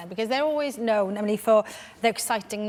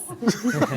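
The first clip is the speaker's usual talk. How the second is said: amused laugh. Real, not acted. Laughing over the name.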